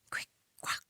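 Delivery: usual speaking voice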